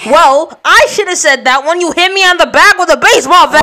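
angry yell. Acted. Toby yells at Angus in quarrel.

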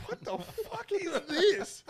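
very high and slow, drawn-out disbelief; stunned disbelief. Retold or imitated. Couple hearing loud neighbours at night, stunned high disbelief. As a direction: stretch it out, utterly stunned.

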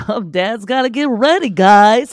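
perky excited dad impression; upbeat dad getting ready, lightly voiced; mock cheerful dad. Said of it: "You're gonna be like".

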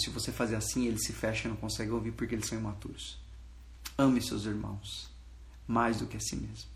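gentle, solemn counsel. Retold or imitated. Preacher relaying what God told him, gentle and solemn. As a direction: hush it down into tender correction.

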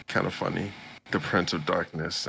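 normal talking voice

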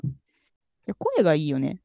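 usual speaking voice

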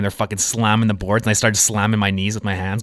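ordinary talk, nothing marked

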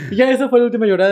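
fast and laughing; giggling wrap-up. Real, not acted. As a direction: rush it out through a laugh. Laughing while telling.

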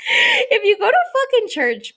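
high, loud, laughing through it. Real, not acted. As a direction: crack up while saying it. Laughing at own idea.

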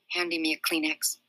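usual speaking voice

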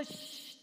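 sharp hissed "shh"; acted shushing sound; silencing shush. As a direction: hush the negative voices. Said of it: "diles, shh".

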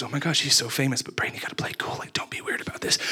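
whispered inner panic, telling himself to stay cool; hushed self-talk trying to play it cool; nervous whisper. Retold or imitated. Inner thoughts near the celebrity.

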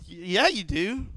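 slow, drawn-out knowing reply; smug reply. Retold or imitated. To bosses offering a promotion, slow and smug. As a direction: savor it, like you saw it coming. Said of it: "And I was like".

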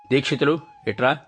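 grandma calling the disciple over sternly; stern summons. A narrator's reading. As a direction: bark his name to come here. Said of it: "అని గద్దింపుగా పిలిచింది".

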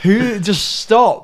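exasperated. Real, not acted. Exasperated rant about lyric cliché.

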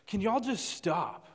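high, slow, fed-up plea; exasperated plea. Retold or imitated. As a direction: act out a girl at her limit, drawing it out. Acted fed-up girl confronts group.

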